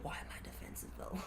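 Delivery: whispered puzzlement; hushed self-questioning. Acted. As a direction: drop suddenly to a whisper.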